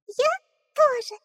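chick voice. Acted. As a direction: squeak it like a newborn chick. Chick echoes.

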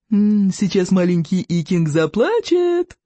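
put-on slow singsong baby-taunt, stretched ending; snorting mockery. A narrator's reading. Rival says little boy will cry, mocking singsong. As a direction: drag it out to mock a crybaby. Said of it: "фыркнул Сморкало".

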